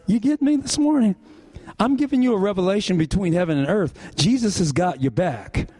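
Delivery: ordinary talk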